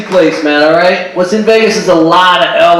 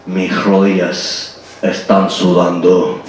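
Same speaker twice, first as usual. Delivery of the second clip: низким медленным бархатным голосом, как Бандерас; соблазнительно, тихо и медленно, под Бандераса; Antonio Banderas voice; 11 semitones lower, 5 dB quieter, slower than usual. Pretend. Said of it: "my best Antonio Banderas voice".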